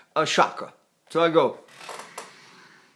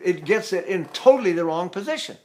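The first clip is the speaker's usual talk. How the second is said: ordinary delivery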